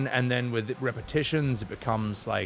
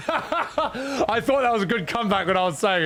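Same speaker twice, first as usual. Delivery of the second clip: laughing; 10 semitones higher than usual. Real, not acted. Laughing at his own comeback.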